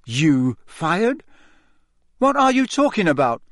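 stunned disbelief; shocked young Harry. A narrator's reading.

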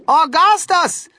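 high, loud, drawn-out mother's call; slow, high-pitched holler of a name. A narrator's reading. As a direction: call out sharply to a disobedient son. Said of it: "called out Missus Gloop".

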